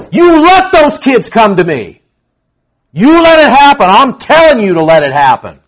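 loud, high commanding shout; angry command. Retold or imitated. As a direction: shout the order like Jesus rebuking them. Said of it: "it's a command".

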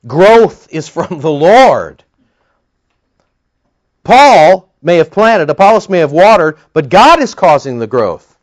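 slow, loud preaching climax. Acted. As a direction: hammer home the verse to the church.

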